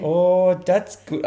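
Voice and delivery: high, loud go at Welsh; Welsh accent. Acted. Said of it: "try the Welsh accent".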